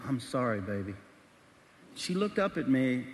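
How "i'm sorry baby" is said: soft, low, gentle apology; falling, hushed reenacted apology; tender apology. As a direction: let it sink softly into a contrite murmur. Apologizing to his crying wife.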